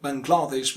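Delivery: Bangladesh as bung-GLAA-desh; stretched second syllable. Shown as a demonstration. Teacher showing how we usually say it, stretched Bangladesh. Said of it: "with a stretch in the second".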